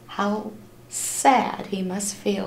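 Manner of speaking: usual speaking voice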